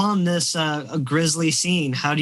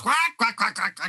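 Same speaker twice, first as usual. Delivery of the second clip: quacking goose; 8 semitones higher than usual. Acted.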